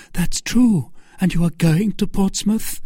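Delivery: high-pitched, lively questioning voice; concede, then ask, pitched high; Milady. Acted. Milady checking Felton's route to Portsmouth, high-pitched. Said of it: "cried Milady".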